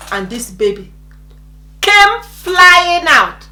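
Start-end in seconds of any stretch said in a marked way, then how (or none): 1.8-3.5 loud, high, very slow stretch; dramatic emphasis. Retold or imitated. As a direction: draw it out for dramatic effect. Dramatizes the baby's sudden birth.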